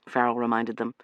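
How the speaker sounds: ordinary delivery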